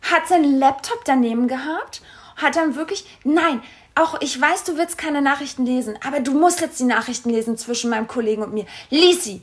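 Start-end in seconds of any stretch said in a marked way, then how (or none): 3.2-9.4 imitated agitation. Boyfriend forcing partner to read messages, imitated agitation. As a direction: act out frantic demands, ending in laughter. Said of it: "Er war auch so richtig aufgebracht".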